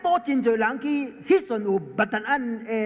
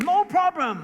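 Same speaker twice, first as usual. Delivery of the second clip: confident reply; 8 semitones higher, 3 dB louder, slower than usual. Retold or imitated. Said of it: "我講：".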